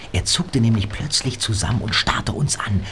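usual speaking voice

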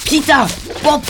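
calling out. Acted. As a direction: call them over, high. Calling friends across the field.

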